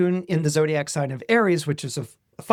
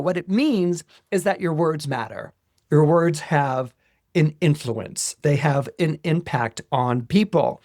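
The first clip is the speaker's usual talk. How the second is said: ordinary delivery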